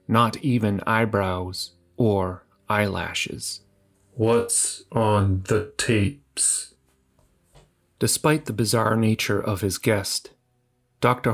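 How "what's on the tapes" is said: monotone voice. Acted. The visitor questions the doctor.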